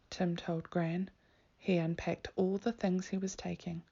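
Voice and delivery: ordinary delivery, nothing marked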